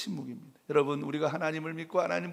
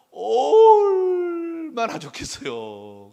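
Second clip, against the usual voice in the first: drawn-out longing cry; 12 semitones higher, 13 dB louder, much slower than usual. Acted. Preacher longing exclamation.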